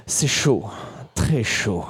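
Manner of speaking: low, soft, savoured words; steamy understatement. Retold or imitated. Comedian confiding a steamy moment to the crowd, low and soft. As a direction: drop low and let each phrase hang.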